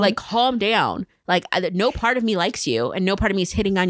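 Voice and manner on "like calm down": drawn out, each word weighted; slow emphatic calm down. Pretend. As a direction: say calm down slowly, pointedly. Repeats slower for emphasis.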